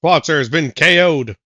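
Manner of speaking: announcer voice; referee voice. Acted. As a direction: call the match like a referee. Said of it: "get out of the referee voice".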